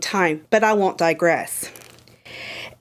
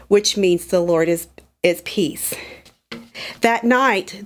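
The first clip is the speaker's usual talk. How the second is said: ordinary talk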